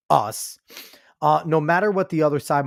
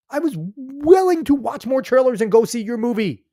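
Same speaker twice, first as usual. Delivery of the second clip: high exasperated rant; 9 semitones higher than usual. Real, not acted. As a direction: vent in disappointed fury. Rant against director.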